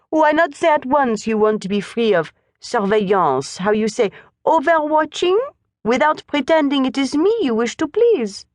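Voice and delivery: put-on a French governess seeing through the boy.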